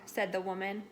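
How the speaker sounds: usual speaking voice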